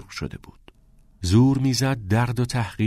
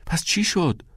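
high, eager questioning; insistent question; 10 semitones higher than usual. A narrator's reading. Friend asking the pupil once more, insistent and high. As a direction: press for an answer, pitch well up.

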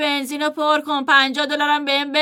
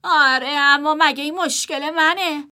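indifferent; 6 semitones higher, 3 dB louder, slower than usual. Acted. Brother shrugs it off.

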